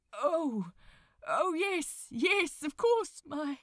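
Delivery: holding back heartbreak, voice catching; words catching in throat. A narrator's reading. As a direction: choke on the words as they climb. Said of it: "his words almost caught in his throat".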